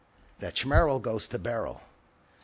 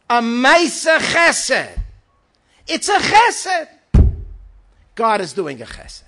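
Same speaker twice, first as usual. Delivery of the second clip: loud, high, fervent sermon voice; preaching at full force; 13 semitones higher, 13 dB louder than usual. Acted. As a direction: preach it with soaring fire. Fiery preaching about God's chesed.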